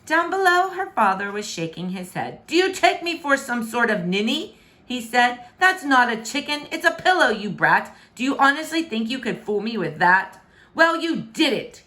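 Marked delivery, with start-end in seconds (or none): 2.5-4.6 loud indignant king. Acted. King scolding daughter. 5.6-10.4 loud scolding, higher; angry. Acted. King scolding, calls her brat. 10.7-11.8 withering. Acted. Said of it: "with a withering look".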